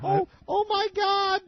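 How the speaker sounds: high, slow shocked granny; mock panicked old lady. Old lady getting a lawyer's letter, high and slow panic. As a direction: gasp it out like a frightened old lady.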